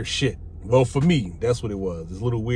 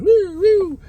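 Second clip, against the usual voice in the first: high, loud woo woo siren wail; siren imitation; 7 dB louder than usual. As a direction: mimic a police siren with your voice.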